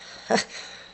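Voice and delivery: long breathy sigh out; open-mouth exhale. Shown as a demonstration. Teacher showing the physiological sigh, open-mouth exhale. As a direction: let all the air rush out through the mouth. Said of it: "Exhaling through an open mouth".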